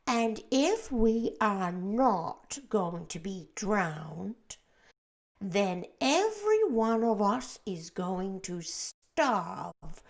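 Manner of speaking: loud, very slow gloom; doomy earthworm. A narrator's reading. The earthworm warns everyone they will starve. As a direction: drag out the worst outcome.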